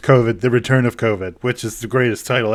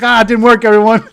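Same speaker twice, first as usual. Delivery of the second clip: higher, louder hammed-up announcement; mock dismay; 9 semitones higher, 7 dB louder, faster than usual. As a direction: call it out to the group, disappointed. Girl reports failed trick.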